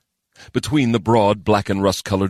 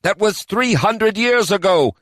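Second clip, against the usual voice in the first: a hint of a higher, heavy elf; lightly voiced elf officer; 3 dB louder, slower than usual. A narrator's reading. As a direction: report the bad news plainly. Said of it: "Kadan said".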